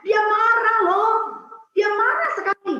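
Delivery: high, loud, drawn-out exclamation; dramatic emphasis. Real, not acted. Speaker tells women how the auntie reacted, dramatic emphasis. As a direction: tell the shock slowly, loud and high.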